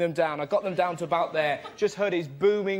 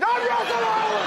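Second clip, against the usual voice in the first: proud shout. Acted. Caught by the crowd, proudly shouting his own name. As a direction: announce yourself grandly.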